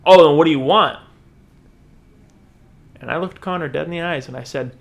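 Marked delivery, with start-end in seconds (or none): 0.0-1.0 loud, impatient comeback; acted confrontational retort. Connor demands to know what the stranger wants, confrontational. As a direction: play a loud, brusque challenge.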